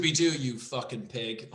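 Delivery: usual speaking voice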